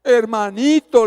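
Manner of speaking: slow, loud, sugary greeting; forced smile. Retold or imitated. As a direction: drag it out through a put-on smile. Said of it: "puse una sonrisa ahora". (saludo alargado con sonrisa fingida)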